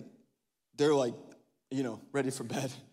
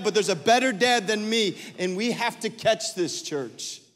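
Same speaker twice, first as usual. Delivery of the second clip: rising, louder sermon cadence; building preaching; 5 semitones higher, 7 dB louder than usual. Acted. Pastor assuring fathers of a better dad, preached with force. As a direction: build each line stronger than the last.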